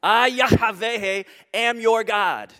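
slow and loud, I and Yahweh stretched out; booming declaration. Shown as a demonstration. Preacher declaring God's name to the church, loud and slow. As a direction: proclaim I, Yahweh like thunder.

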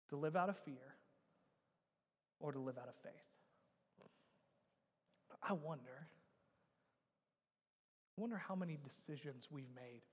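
low, very soft voice with long pauses; hushed, solemn preaching. Real, not acted. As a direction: let the choice sink in quietly. Preacher drops to hush.

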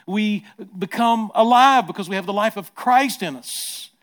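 high, loud, driving preaching; preaching at full force. Acted. Preacher promising the church resurrection life, in full-force preaching. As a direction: hammer each line home to the crowd.

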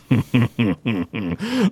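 villain chuckle. Acted. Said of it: "Groon just sort of goes".

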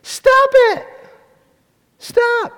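high-pitched yell from another room; mock child's yell. Child downstairs passing on the order to a sibling, yelled high. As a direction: yell it high, like a squabbling kid. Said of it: "you hear someone yell".

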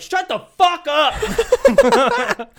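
very high, loud, drawn-out mock shout; explode, stretching the words to breaking point; exasperated parent yell. Parents silencing chanting kids in car, hammed-up exasperated shout.

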